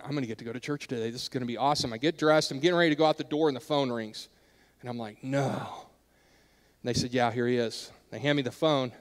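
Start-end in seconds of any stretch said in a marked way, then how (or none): 0.0-1.9 soft, low, quick inner excitement; whisper-quiet hope to get to church; hushed hopeful thought. Retold or imitated. 3.5-8.9 soft, low retelling of the letdown; hushed dismay. Retold or imitated. Phone rings, dismay.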